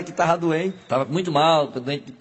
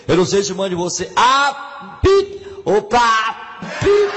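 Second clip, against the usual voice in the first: slow, much higher exasperated shout; shouted punchline; 6 semitones higher, 5 dB louder, much slower than usual. Acted. Seu Lunga snaps back at the nosy passenger, loud. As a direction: blow up on the last word.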